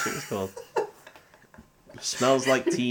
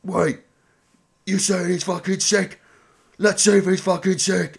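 higher, mocking Cockney orc sneer; 8 semitones higher than usual. Orc leader taunting the hobbits, a mocking sneer. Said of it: "he's just like".

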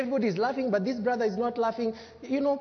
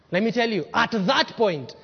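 ordinary talk

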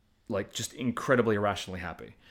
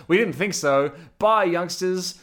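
higher, louder, slow sendoff; a jokey, slowed farewell, pitched up; 7 semitones higher, 6 dB louder, slower than usual. Retold or imitated. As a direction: wave the kids off in a hammed-up elder voice. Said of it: "where they let 'em out and go".

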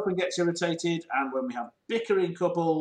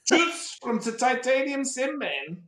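mock sign-off; 7 semitones higher, slower than usual. Signing off with a flourish.

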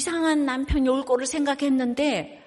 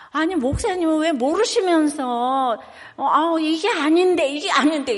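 higher, louder mock objection; imitated exasperated protest; 5 semitones higher, 6 dB louder than usual. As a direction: act out a frustrated church member protesting. Acts out a member's repeated protest.

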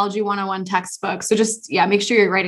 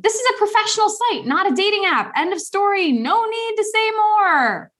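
incensed; 9 semitones higher than usual. Retold or imitated. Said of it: "So if she's incensed".

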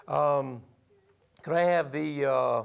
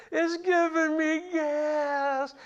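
much higher, slow hammed-up complaint; mock queasy; 12 semitones higher, much slower than usual. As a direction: whine it like a kid on a ride. Joking queasy on log ride.

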